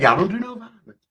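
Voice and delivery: high, prissy-sounding mock plea; mocking politician. Mocking the politicians' fake call for peace, a high voice. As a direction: sneer as the phony peacemaker.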